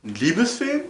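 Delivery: normal talking voice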